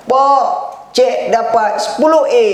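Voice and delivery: put-on a boy bursting with good news; excited shout. Son shouting his exam results to father, high and excited.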